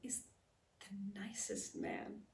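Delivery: slow, near-whispered praise; hushed tenderness. Acted. Describing the man she knew, hushed tenderness. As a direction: let it out slowly, barely voiced.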